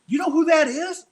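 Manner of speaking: high, loud, eager question; pointing at the screen, thrilled; retold excitement, pitched high; excited question. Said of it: "I was like".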